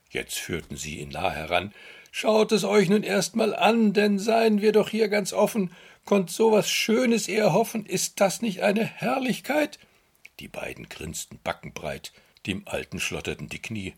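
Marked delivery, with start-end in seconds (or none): 2.1-9.8 high, loud sly salesman voice; slowing to show off; sly weaver. Acted. Weavers showing the empty loom.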